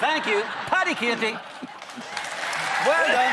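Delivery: put-on chat show host voice; Late Late Show host impression. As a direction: congratulate like an old-school TV host. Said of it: "There is one Late Late Show host".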